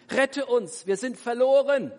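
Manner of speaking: loud, high desperate shout; screaming for rescue. Acted. Disciples scream at sleeping Jesus for rescue, frantic. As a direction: cry out as the boat goes under. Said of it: "schrien sie".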